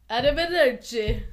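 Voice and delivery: put-on loud Italian accent goodbye. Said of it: "say goodbye in an Italian accent".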